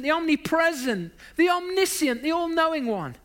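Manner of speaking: high, building list, each title punched; impassioned preacher's roll-call; emphatic preaching. Real, not acted. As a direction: proclaim each title with rising fervour.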